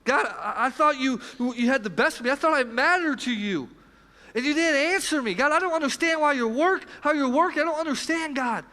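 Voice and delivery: angry complaint to God. Retold or imitated. The angry voice in our head accusing God, hurt and loud. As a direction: let the hurt boil up toward God. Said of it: "Anger says".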